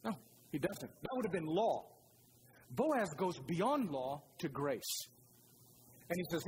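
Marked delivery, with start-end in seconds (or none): 2.7-5.1 each word laid down slowly; slow emphatic point. Acted. Preacher slowing to land the sermon's key point.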